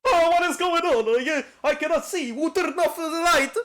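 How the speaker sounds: high, loud put-on Russian accent that drifts toward Italian; mock Russian accent. Streamer voicing blinded Harvey for chat, loud mock Russian accent. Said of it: "a very thick, uh, Russian accent".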